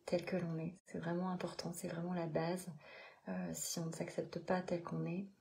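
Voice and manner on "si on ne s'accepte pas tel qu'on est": ordinary delivery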